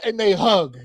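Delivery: ordinary delivery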